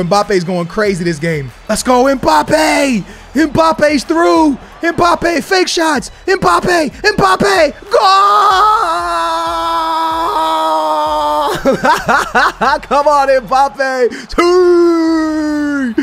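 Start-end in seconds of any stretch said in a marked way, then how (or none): 1.7-8.0 excited yelling. Real, not acted. Yelling as Mbappe runs in. 8.1-12.8 long, high laughing shout; ecstatic laugh. Real, not acted. Ecstatic laughing shout after goal. 12.8-15.9 slow, laughing, then high shout; laughing celebration. Real, not acted.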